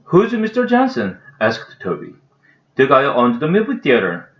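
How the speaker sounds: careful, lively English read-aloud with voiced characters; story reading. A narrator's reading. Reading a children's book aloud, animated.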